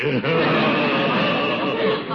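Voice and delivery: rolling self-satisfied chuckle. Acted. Gildersleeve laughing at his own chest joke, rolling chuckle.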